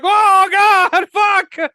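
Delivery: high, loud hammed-up outburst of alarm; mock panicked shout. Fan acting out the friends' reaction to the villain, mock panicked shout. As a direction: yell it like you've just been threatened.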